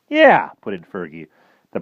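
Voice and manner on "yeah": much higher, louder quick agreement; eager boy chiming in. Acted. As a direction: jump in eagerly. Fergie chimes in.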